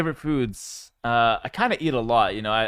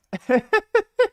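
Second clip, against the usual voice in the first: a shrill, much higher cackle of disbelief; high squealing laugh; 7 dB louder than usual. Real, not acted. Laughing hard at chat's McDonald's suggestion. Chat suggests American fast food.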